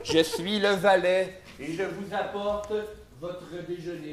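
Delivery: low, slow, soft butler. Acted. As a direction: serve it like a polite valet. Improv, plays a butler serving. (voix de majordome grave et lente)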